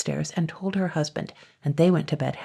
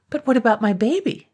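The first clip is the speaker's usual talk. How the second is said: anxious plea; 7 semitones higher, 4 dB louder than usual. Acted. John pleads for his baby.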